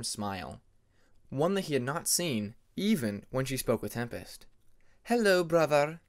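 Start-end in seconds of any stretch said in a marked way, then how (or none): 5.0-6.0 a hint of a stiff, proper hello; formal greeting. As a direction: greet him with reserved formality. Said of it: "Ermine spoke formally".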